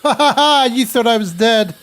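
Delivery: loud, high triumphant gloat; gloating villain. Acted. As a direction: crow it like a cartoon villain. Survives danger, gloats at chat.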